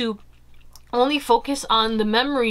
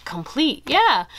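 usual speaking voice

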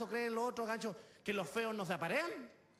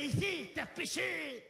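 drawn-out, higher monster cry; 7 semitones higher, much slower than usual. Acted. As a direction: stretch it out like a looming monster. Voices the monster accusing the boy. (voz de monstruo, alargada y aguda)

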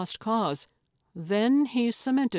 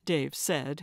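usual speaking voice

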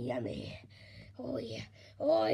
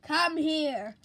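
ordinary delivery

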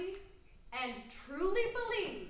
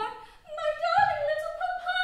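much higher, sing-song coaxing; mock tender daughter; 11 semitones higher, 7 dB louder than usual. Servant imitating the daughter coaxing her papa, mock tender. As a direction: imitate the daughter sweetly wheedling her father. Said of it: "said very tenderly".

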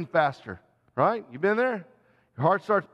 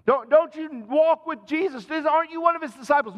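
high, fast, pressing accusation; insistent accuser; 11 semitones higher, 4 dB louder than usual. Acted. Bystander pressing Peter a third time, high and insistent. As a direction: corner him with the question.

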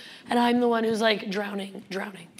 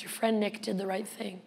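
ordinary talk

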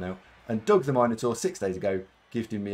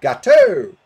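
very high, loud mock yell of Gateau; hammed-up shriek on the last vowel of Gateau; imitated shout; 13 dB louder than usual. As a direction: holler Gateau with a blaring, drawn-out "oh". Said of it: "Ogun is going".

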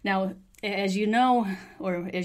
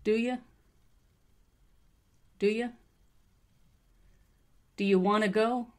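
short higher-pitched question chunks; listen-and-repeat model; 4 semitones higher than usual. Shown as a demonstration. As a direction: model each rising phrase for learners. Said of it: "listen and repeat after me".

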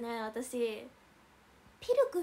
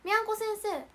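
ordinary talk

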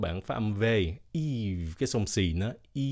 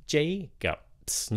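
"Jacobs" high on "Jay", dropping on "cobs"; falling second syllable; 5 semitones higher than usual. Shown as a demonstration. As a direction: start "Jacobs" high, step down to "cups". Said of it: "các bạn xuống giọng nó cặp".

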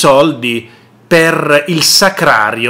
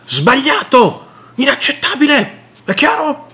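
much higher, indignant mock voice; mocking Bonaccini; 9 semitones higher than usual. Regional president protesting the cuts, high mock indignation. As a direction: squeak it out like an offended official. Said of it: "il presidente della regione Bonaccini dice".

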